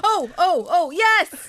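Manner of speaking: high, excited little bursts; rising excitement. Real, not acted. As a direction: build to a triumphant yes as it nearly works. Wrapper starting to come off.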